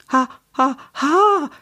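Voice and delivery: high gasps, rising; panting gasps. A narrator's reading. Papa stunned by the snack bar plan, panting. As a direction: gasp as if the food were too hot. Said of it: "keuchte Papa, als hätte er etwas zu Heißes gegessen".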